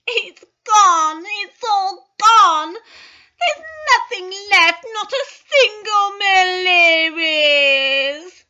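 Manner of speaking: high, loud, slow crying wail; wailing sobs. Acted. Twins wailing to each other in hospital beds, sobbing loudly. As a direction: sob it out, everything is lost. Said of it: "The twins were crying".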